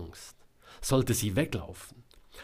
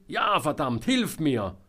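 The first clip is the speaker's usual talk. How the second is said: raised, loud plea for help; pleading; 6 semitones higher, 6 dB louder than usual. A narrator's reading. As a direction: beg urgently, cursing. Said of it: "Georg klang jetzt flehend". (flehend um Hilfe rufen)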